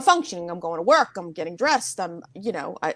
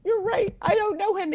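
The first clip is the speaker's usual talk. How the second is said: very high, weepy agreement; imitating herself in tears; mock sobbing; 13 semitones higher than usual. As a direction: concede in a tiny tearful squeak. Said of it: "I'm sobbing".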